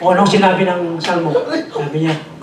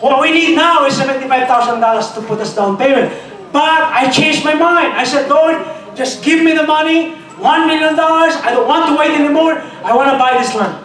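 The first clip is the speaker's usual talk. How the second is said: higher, louder preacher's shout; full-force preaching; 7 semitones higher, 4 dB louder than usual. Acted. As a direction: drive it home to the congregation. Preaching about raising funds.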